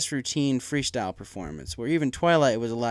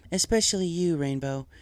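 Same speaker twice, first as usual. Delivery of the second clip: Celestia complimenting; 5 semitones higher than usual. A narrator's reading. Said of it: "Celestia complimented".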